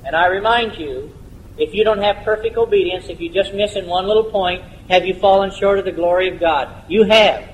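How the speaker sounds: ordinary delivery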